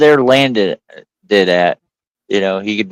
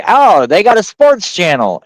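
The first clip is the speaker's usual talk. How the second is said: higher, keen quoted reaction; retold delighted discovery; 8 semitones higher than usual. Telling friends about finding TV while deployed, higher retold excitement. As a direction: perk up as if discovering it. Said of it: "So I'm like".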